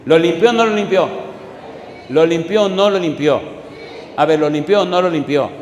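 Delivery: preacher rallying the crowd; rousing call-and-response. Real, not acted. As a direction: challenge the crowd to reply.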